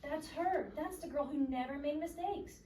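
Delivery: high, eager fan voice; excited whisper-gossip. A narrator's reading. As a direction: point her out excitedly. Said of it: "said a woman".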